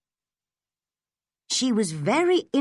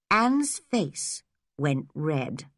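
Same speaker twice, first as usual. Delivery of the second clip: plain reading voice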